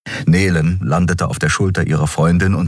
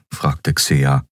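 ordinary delivery

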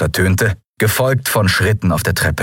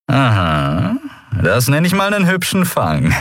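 pleased pirate; 7 semitones higher than usual. A narrator's reading.

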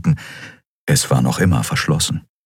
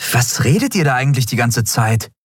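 higher-voiced, impatient interruption; raised-pitch interjection; cutting in; 7 semitones higher than usual. A narrator's reading. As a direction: cut in impatiently. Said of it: "fuhr ihn Endriel dazwischen".

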